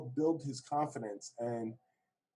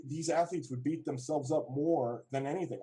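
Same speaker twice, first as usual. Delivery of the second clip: ordinary delivery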